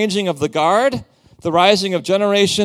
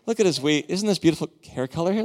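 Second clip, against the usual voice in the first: normal talking voice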